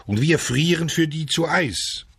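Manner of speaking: high-pitched indignant complaint; angry grumbling. A narrator's reading. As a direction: gripe in a fury at those dawdling inside. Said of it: "den packte die Wut".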